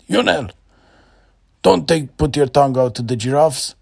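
high, loud telling-off; a father scolding his boy; scolding dad. A narrator's reading. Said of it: "And his dad said".

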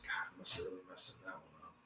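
very quiet, quick self-reproach; under his breath. Acted. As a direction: mumble it to yourself, barely audible, hurried. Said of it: "you hear him under his breath".

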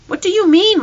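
high, loud startled question; alarmed. A narrator's reading. Mother questions son about nearly dying, alarmed. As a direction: demand an explanation in alarm.

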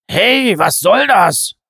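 loud, high, slow huffy protest; offended. A narrator's reading. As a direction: sound wounded and indignant. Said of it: "beleidigt zu klingen".

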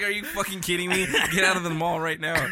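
imitating an irate security guard yelling; angry guard yelling. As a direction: play the furious mall cop throwing him out.

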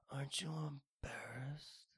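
whispered, slow "Aren't you embarrassed"; a weak breathy imitation of Sebastian Maniscalco; bad Sebastian impression. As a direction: hush it out like a disappointed comic. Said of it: "It's a terrible impression".

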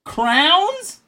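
high, loud rising question; incredulous echo. Real, not acted. Echoing friend's pronunciation, loudly incredulous. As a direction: throw it back in loud disbelief. Echoes friend's word in disbelief.

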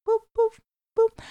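high vocal boops; sung boops. Shown as a demonstration. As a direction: pop out little high sung notes. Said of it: "get me singing".

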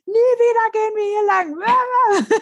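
very high, hammed-up exclamation breaking into laughter; mock outraged phone call. Partner phoning after being yelled at, mock outrage. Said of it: "der rief mich so an".

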